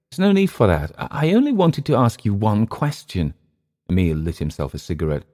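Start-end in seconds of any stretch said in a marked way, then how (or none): 0.1-3.3 high-pitched, hesitant protest; apologetic Englishman. Acted. Clift to newly woken Emile, apologetic. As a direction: protest high, then stumble into the request.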